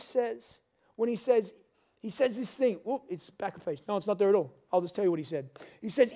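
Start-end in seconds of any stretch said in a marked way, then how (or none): none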